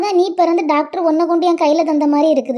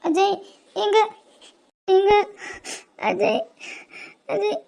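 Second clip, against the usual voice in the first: crying; 3 semitones higher, 4 dB quieter, slower than usual. Acted. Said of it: "எதுக்கு இப்படி அழுற?".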